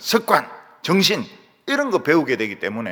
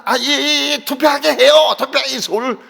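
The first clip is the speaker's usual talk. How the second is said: Seoul speech; 8 semitones higher, 6 dB louder than usual. Retold or imitated. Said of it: "이 서울 사람들은".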